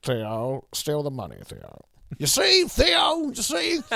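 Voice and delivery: high, slow, shouted mock impression; a hammed-up celebrity impression; Savile-style impression. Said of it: "It's like doing a Jimmy Savile impression".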